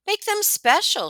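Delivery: high, gentle teacher's voice. A narrator's reading. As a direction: coax the class warmly. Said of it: "she says".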